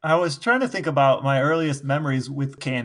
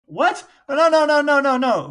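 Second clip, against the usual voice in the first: high, loud string of no's; rapid-fire playful objection, much higher and louder; excited protest; 11 semitones higher, 6 dB louder than usual. Real, not acted. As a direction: leap in to defend your favorite candy. Defending Ring Pops, protesting.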